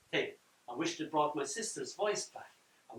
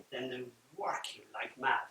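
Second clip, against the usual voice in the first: whisper; 5 semitones lower, 6 dB quieter than usual. Real, not acted. Whispered aside in anecdote.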